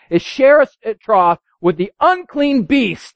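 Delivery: high, loud, fervent reading of the text; preaching scripture. Acted. Pastor reading Luke to congregation, high and loud. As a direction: proclaim the parable to the church.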